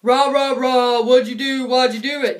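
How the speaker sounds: imitating a cop barking questions; mock cop bluster. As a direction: play a pushy cop, hammed-up. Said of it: "and he's like".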